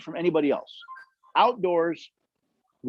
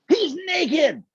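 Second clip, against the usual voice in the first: put-on child's voice pitched much higher, blurting it out; little kid; 10 semitones higher than usual. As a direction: play the kid who points and says it plainly. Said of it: "one kid pointed and said".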